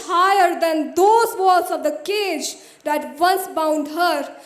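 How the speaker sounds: loud, forceful poetic climax; triumphant declamation. Acted. Poet declaring the bird will shatter the walls, loud triumphant recital. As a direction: build from the first phrase into ringing, emphatic proclamation.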